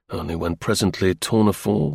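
plain reading voice

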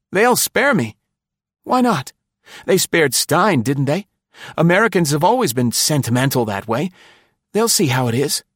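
high, pleading and hopeful; cling to a last hope; frightened man talking himself into rescue, high; desperate hope. A narrator's reading.

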